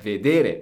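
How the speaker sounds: vedere as ve-DE-re, said loudly; stress on second syllable. Shown as a demonstration. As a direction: confirm vedere with a strong middle syllable. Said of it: "Very good".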